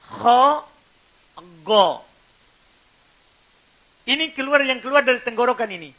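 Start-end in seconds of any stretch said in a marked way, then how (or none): 0.1-2.1 Kho rasped loud at the top of the throat, go voiced; upper throat letters. Shown as a demonstration. Teacher showing the top throat letters, loud rasping demo. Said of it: "Yaitu kho sama goin".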